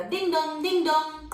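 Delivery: high, loud sung doorbell chime; doorbell ding dong. Acted. Teacher imitating the doorbell for the kids, high chime. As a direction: ring it out like a doorbell.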